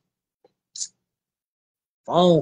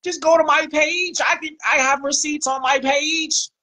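higher, mocking the guy's plea; mocking quote; 6 semitones higher than usual. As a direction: sneer his line back at him, a little higher. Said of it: "He talking about".

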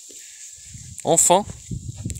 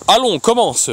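sharply raised, impatient order; roared command; 8 semitones higher, 4 dB louder than usual. A narrator's reading. Magician ordering the executioner to begin, roaring. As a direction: roar it, high and forceful. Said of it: "rugit le magicien".

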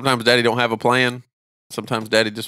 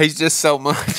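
slow, whiny mock lament; exasperated wife; 4 semitones higher, slower than usual. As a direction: echo the wife's despair, slowed down. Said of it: "I'm on the porch with her saying".

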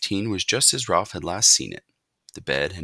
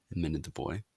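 ordinary delivery, nothing marked

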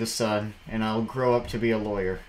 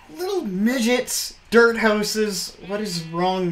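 high, loud disbelieving cries; indignant outburst; outraged exclamation; 11 semitones higher, 7 dB louder, much slower than usual. Real, not acted. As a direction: exclaim in shocked disgust. Outraged at griefed server.

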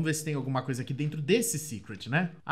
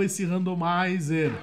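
normal talking voice

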